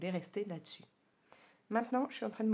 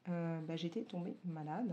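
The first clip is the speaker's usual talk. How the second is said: ordinary delivery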